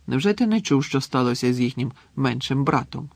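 ordinary delivery

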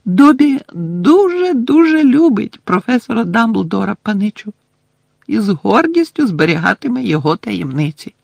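high squeaky elf voice, proud; a little elf proudly keeping secrets; house-elf Dobby. Acted. Elf character voice. (писклявий гордий голос ельфа)

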